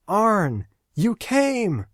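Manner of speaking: put-on high, bright-eyed young woman calling out; Lori's joyful greeting. Lori greets Arn in a dream.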